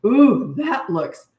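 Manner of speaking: a slow, drawn-out mock "Ooh"; dad overplaying delight, slow and high; hammed-up dad admiration. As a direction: fake amazement for his little girl. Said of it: "And he'd go".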